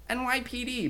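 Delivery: whiny, high-pitched cop announcing himself; mock a squeaky, whiny action hero; high-pitched whiny John McClane. Imitating game McClane's first entrance, high-pitched and whiny. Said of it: "high-pitched, whiny voice".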